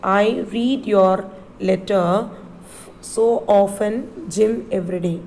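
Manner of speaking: "I read your letter" slowed right down; slow repeat. Shown as a demonstration. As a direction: repeat it slowly so they follow. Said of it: "I'll repeat".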